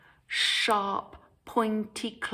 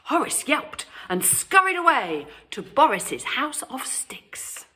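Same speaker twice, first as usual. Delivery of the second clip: ordinary delivery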